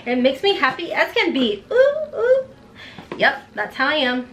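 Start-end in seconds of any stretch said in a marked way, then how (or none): 1.7-2.5 high giggly ooh squeals; laughing high-pitched squeals; excited squeal. Real, not acted. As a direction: squeal with glee. Squealing over a gift.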